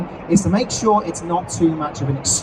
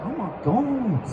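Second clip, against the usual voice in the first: soft, slow, higher mock amazement; amazed reaction; 6 semitones higher, 4 dB quieter, slower than usual. Players surprised by new voice, amazed. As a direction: act awestruck, drawing the words out. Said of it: "they'll be like".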